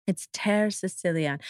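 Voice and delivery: attempted Italian accent. Shown as a demonstration. Wine podcast host retrying the Italian name, soft Italian accent. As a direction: try the Italian accent on Terre Siciliane, quietly. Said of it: "is my Italian accent so".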